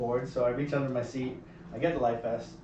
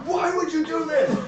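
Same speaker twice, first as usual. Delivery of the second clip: much higher, slower exasperated scolding; mock stern flight attendant; 13 semitones higher, 5 dB louder, slower than usual. Flight attendant scolding the pranksters, mock stern. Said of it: "flight attendant came over and was like".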